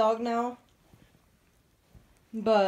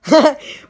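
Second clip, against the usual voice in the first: a sudden loud burst of laughter; 4 semitones higher, 12 dB louder than usual. Real, not acted. Laughing at a friend's middle finger emoji, loud burst. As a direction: let a big laugh burst out.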